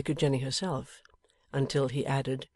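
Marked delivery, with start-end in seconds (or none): none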